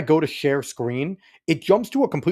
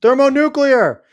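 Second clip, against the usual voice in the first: high, loud corrective yell; loud, high, exasperated correction; exasperated shout; 13 semitones higher, 11 dB louder, slower than usual. Real, not acted. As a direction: shout the right way to say Nuclear. Correcting Benny's pronunciation loudly.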